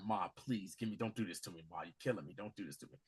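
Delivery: soft, low retold sobbing plea; mock crying plea. Son kneeling by his mother's bed, understated tearful plea. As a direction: plead with her, quietly breaking. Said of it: "on the bedside crying".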